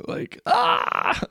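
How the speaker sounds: sudden high unvoiced "ah!", imitating a scared shriek; a quick breathy mock shriek of fright; mock scream. Retelling a sudden instant freakout.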